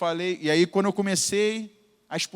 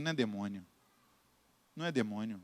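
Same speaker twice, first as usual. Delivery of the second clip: muttered aside; 9 semitones lower, 12 dB quieter, faster than usual. Retold or imitated. Quiet aside retold.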